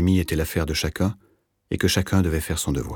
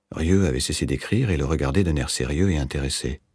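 ordinary delivery, nothing marked

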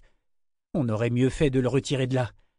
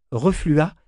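ordinary delivery, nothing marked

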